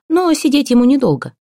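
high, projected announcement; loud, for all to hear. A narrator's reading. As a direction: pitch it up so the whole room hears. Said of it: "громко, чтобы все слышали".

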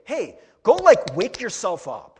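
hammed-up pushy command; mock demanding command. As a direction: bark it as a mock wake-up call. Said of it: "He doesn't say".

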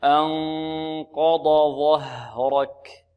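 "أنقض ظهرك" slow, dad released before thick zha; slow recitation. Shown as a demonstration. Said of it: "لا نقول ظه". (ترتيل بطيء مع فصل الضاد عن الظاء)

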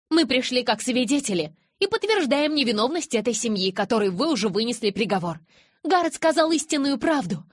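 high, forceful declaration; impassioned testimony. A narrator's reading. Witness defending a condemned family, impassioned and high.

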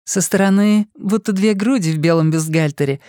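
put-on bubbly sorority girl. A narrator's reading. Fortuna's voice voiced by narrator.

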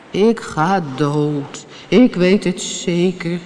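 slow, drawn-out moan; sorry, self-pitying drawn-out wail; wailing whale. A narrator's reading. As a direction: lament slowly like a dying whale. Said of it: "hoorde ze de walvis zacht jammeren".

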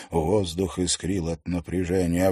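ordinary delivery

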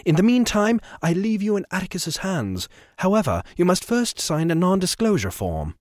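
high, clipped cold anger; angry gentleman. Acted. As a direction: threaten her with icy politeness. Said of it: "His eyes burned with anger".

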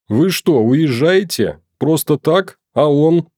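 indignant outcry. A narrator's reading. Caretaker shouting at police leaving Maxim, indignant. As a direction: protest as they drive off. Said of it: "закричала дворничиха".

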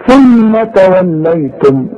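ثم with its م humming for two beats; nasal ghunna. Shown as a demonstration. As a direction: hold the ghunna on ثم. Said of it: "يغنوا بمقدار حركتين".

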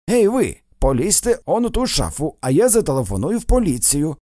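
acted commanding call; sharp order. A narrator's reading. Orders intruder into wardrobe, threatens police.

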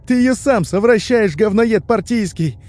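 very high, hysterical yell; shrieked insult at breaking point; cracking scream. A narrator's reading. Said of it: "срывающимся голосом вопит Толик".

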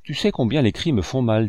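plain reading voice

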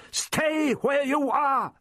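high, drawn-out warning shout; urgent order. A narrator's reading. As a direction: cry out to hold the child back. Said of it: "he rapped".

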